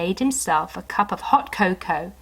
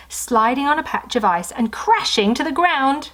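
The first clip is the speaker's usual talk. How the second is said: ordinary delivery, nothing marked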